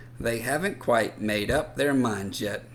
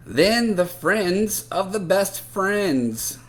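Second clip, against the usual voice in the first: drawn out and raised; slow emphatic telling; 6 semitones higher, 5 dB louder, slower than usual. A narrator's reading. Narrator slowing down over the last kids picked. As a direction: stretch it out, louder. Building list of picks.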